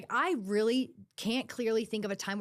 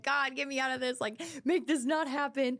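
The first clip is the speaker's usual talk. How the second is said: higher-pitched, lightly acted plea; mock pleading; 4 semitones higher than usual. Caught cheating in school, mock pleading prayer to God. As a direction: imitate a kid begging for a way out. Imitates past self begging God.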